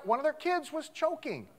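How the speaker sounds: ordinary talk, nothing marked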